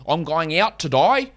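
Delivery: mock broad fake Australian; bad Australian accent. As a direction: put on a botched Australian accent. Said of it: "a bad Australian accent saying".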